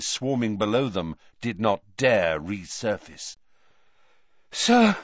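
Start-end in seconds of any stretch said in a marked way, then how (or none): 4.5-5.0 breathless, higher cry; breathless high call; panting. A narrator's reading. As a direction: gasp out the word, winded. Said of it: "panted Harry".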